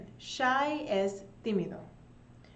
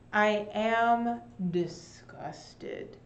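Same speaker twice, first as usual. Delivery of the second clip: acted disgust; 4 dB louder, much slower than usual. Shown as a demonstration. Teacher acting out feeling words.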